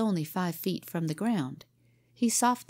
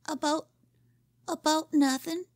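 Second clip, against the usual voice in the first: timid stammer; 10 semitones higher than usual. Acted. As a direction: stammer it out, caught and afraid. Scared boy stammers.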